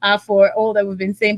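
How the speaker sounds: usual speaking voice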